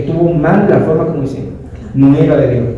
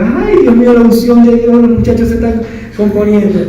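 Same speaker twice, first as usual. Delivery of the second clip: high, excited churchy exclamation; mimicking the pastor's wife; 8 semitones higher than usual. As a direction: gush like a thrilled pastor's wife. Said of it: "llega la pastora muy tranquila". (la pastora exclama emocionada y aguda)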